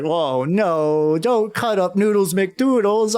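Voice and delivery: high whiny pleading clown; sad clown voice. Acted. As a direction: beg in a high silly clown voice. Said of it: "puts on a sad face like".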